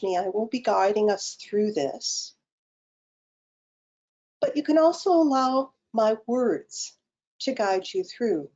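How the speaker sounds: calm, unhurried meditation guide with long pauses; guided meditation. Acted. Guide leading online participants through breathing and self-massage, guided meditation. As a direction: lead them gently into the breath, phrase by phrase.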